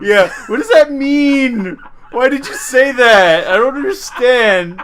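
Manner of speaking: high, slow hammed-up whimper; mock crying. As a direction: wail in fake bewilderment.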